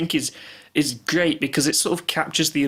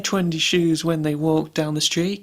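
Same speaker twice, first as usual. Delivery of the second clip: higher-pitched recital of a comic lyric; performed lyric; 6 semitones higher than usual. Retold or imitated. Podcast host quotes favourite album line to co-host, performed lyric. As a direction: play up the silly line, drawing out the end.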